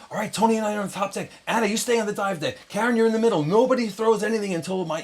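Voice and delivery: high, urgent imitation of a commander; commanding orders. Friend directs crew by walkie-talkie, urgently commanding. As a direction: imitate barking battle positions over a radio.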